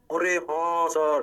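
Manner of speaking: slow, sustained "yes sir"; put-on chorus of realization; mock student agreement. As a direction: stretch the agreement on a level note. Acts out class's drawn-out agreement.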